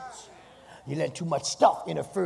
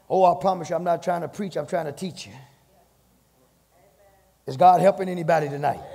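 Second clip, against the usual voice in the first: louder preacher's call to the room; preaching call; 2 semitones higher, 7 dB louder than usual. Acted. Preaching push.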